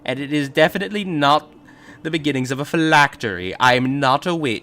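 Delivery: loud cackling witch voice. Acted. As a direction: play it as a wicked witch, loud. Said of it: "That is just such a witch voice".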